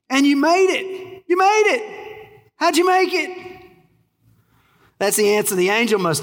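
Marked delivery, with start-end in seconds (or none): 0.1-3.3 high-pitched, incredulous wonder; astonished, voice leaping way up; acted amazement. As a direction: marvel at an impossible success. Imagined amazed question to the thief.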